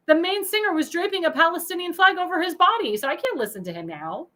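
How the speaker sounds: normal talking voice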